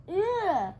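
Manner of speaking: high loud disgusted cry; disgusted ugh. A narrator's reading. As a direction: recoil in disgust. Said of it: "cried Dora".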